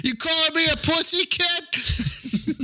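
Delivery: very high-pitched put-on character voice; hammed-up high-voiced tough guy; mock challenge. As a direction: squeal it as a comic challenge. Continuing the comic Boston tough-guy bit.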